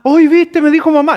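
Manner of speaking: high, loud proud gush; excited proud parent. Retold or imitated. Pastor voicing a proud parent bragging about baby's first word, excited. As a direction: brag like a thrilled new parent. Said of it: "proud parent bragging".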